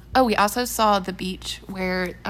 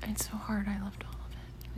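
whispering; 10 dB quieter than usual. Real, not acted. Soft whispered answer.

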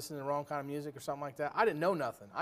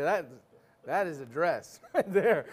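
evasive compliment with laugh; 5 dB louder, slower than usual. Pretend. Evasive husband complimenting dress.

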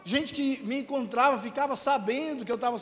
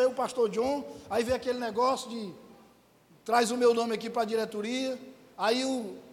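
ordinary talk

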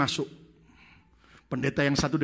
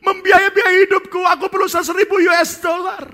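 wailing confession; 14 dB louder than usual. Acted. Pastor confessing the stolen money to friends, loud sobbing wail. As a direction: sob out the excuse. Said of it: "mulai nangis".

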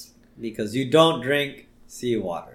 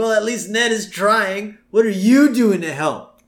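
higher, slow reproach; drawn-out mock scolding, pitched up; Bart unimpressed; 7 semitones higher, 5 dB louder, slower than usual. Son scolding his father on the raft, unimpressed. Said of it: "even Bart's not impressed with this".

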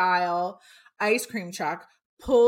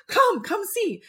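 eager urging; 13 semitones higher than usual. Retold or imitated. Urging friends to look.

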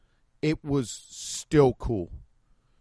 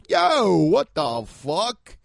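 slow, drawn-out New Yorker Yo with a louder lift; New York street voice; 4 semitones higher, 4 dB louder, much slower than usual. Retold or imitated. New Yorker reacting on the street, New York accent drawl. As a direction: stretch it like a New York guy on the corner. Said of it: "you hear somebody go".

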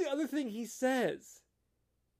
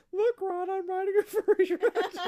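high silly voice; 10 semitones higher than usual. Retold or imitated. Quoting line, high voice.

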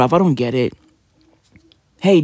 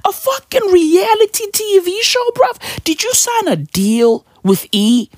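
much higher, squeaky mock voice asking the friend; mock exasperated bro; 11 semitones higher than usual. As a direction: squeak it out like an incredulous mate. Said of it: "And I be like".

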